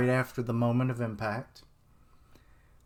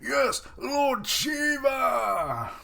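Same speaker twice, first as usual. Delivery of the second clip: slow, much higher drawn-out shout; cheering shout; 13 semitones higher, much slower than usual. Acted. The crowd cheering Shiva standing up, slow high shout. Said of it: "they're all like".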